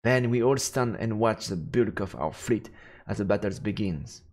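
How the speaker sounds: plain reading voice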